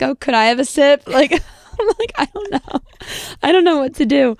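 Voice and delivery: high, giggly panic, laughing through it; laughing nervously at her own panic. Retold or imitated. Retelling her panic to a friend, giggling and high.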